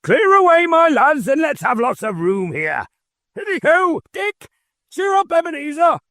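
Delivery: a fat, jovial boss hollering for his apprentices. A narrator's reading. Fezziwig clearing the room.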